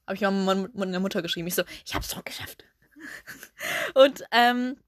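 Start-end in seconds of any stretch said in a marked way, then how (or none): 1.8-2.6 1 geflüsterter Triumph. Retold or imitated. Nachricht an Mutter, geflüstert.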